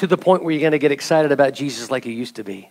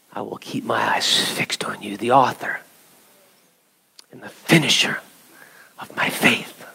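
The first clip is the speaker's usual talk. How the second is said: whispered prayer; hushed prayerful whisper; 3 semitones lower than usual. Acted. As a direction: breathe the words like a private vow. Prayerful quote of scripture.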